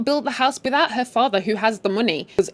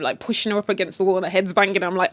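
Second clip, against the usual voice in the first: normal talking voice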